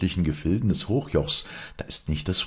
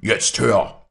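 louder furious shout; 6 semitones higher, 8 dB louder than usual. A narrator's reading. Stromminger shouting at his daughter, furious shout. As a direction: bark the order at her. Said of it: "schrie Stromminger".

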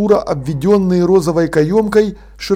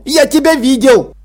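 high, raised accusing cry; angry pitched-up yell; yelled accusation; 8 semitones higher, 4 dB louder than usual. A narrator's reading. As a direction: shout it as an eyewitness accusing. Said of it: "заорал он".

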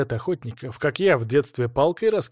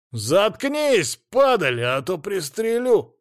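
high, angry shout; yelled threat; 6 semitones higher, much slower than usual. A narrator's reading. Hunter threatening to shoot the hero, yelling. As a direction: raise the voice into a threat. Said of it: "заорал охотник".

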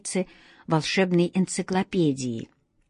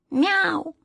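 high offended meow; a cat's hurt howl, pitched up high; offended yowl; 9 semitones higher than usual. A narrator's reading. Fallen cat yowls at the wizard in offence, high meow. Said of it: "с обидой взвыл кот Васька".

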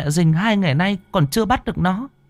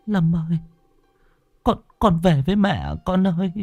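high, softer pleading voice near tears; tearful pleading; 7 semitones higher, 3 dB quieter than usual. A narrator's reading. Mother begging her ghost son to come home, high and tearful. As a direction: beg him gently, close to crying. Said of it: "xúc động như muốn khóc".

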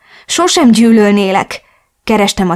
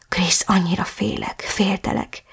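frightened whisper; suttogva; 8 dB quieter than usual. A narrator's reading. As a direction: breathe it out in fear. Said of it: "Láttam a kétségbeesést a szemében". (ijedt suttogás)

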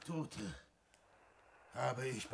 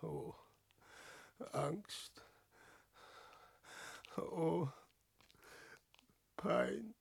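faint, high moan of dread; anguished; 5 semitones higher, 12 dB quieter, slower than usual. Acted. Man laments his lost life, anguished. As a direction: let each word escape softly, far apart.

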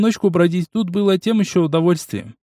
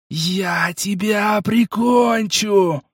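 slow, higher inhuman shouting; monster shout; 7 semitones higher, much slower than usual. A narrator's reading. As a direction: bellow the threat like a beast. Monster threatens to kill hero.

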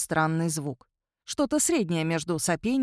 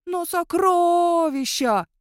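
high, long-drawn wolfish wail; plaintive howl; 10 semitones higher, 5 dB louder, much slower than usual. A narrator's reading. Werewolf banned from digging, loud plaintive howl. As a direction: howl the last word out pitifully. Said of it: "жалобно завыл он".

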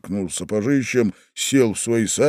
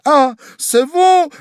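very high, loud, drawn-out greeting; joyful cry; 8 dB louder, slower than usual. A narrator's reading. Wife rushes to her husband, crying out with joy. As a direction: squeal with delight. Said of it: "закричала Глафира Петровна с неподдельной радостью".

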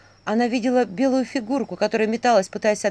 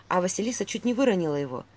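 ordinary delivery, nothing marked

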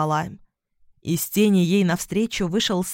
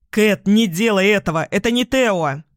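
louder warning call; urgent shout; 5 dB louder than usual. A narrator's reading. Бон running to stop Кэт, a raised urgent shout. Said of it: "кричал на ходу Бон".

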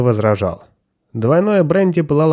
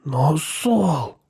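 higher, wavering protest; timid, trembling self-justification; 4 semitones higher, 3 dB quieter than usual. A narrator's reading. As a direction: plead as if excusing yourself. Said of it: "сказал Пол дрожащим голосом".

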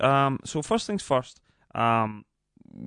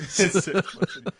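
slow, laughing echo; 7 semitones higher, slower than usual. Real, not acted. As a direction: laugh while repeating it.

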